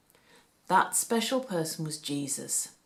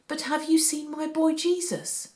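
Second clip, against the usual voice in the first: higher, anxious mother asking strangers; Mary searching, pitched up with worry; worried mother; 10 semitones higher than usual. A narrator's reading. Mary asks strangers about her lost son, pitched up with worry. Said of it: "said Mary".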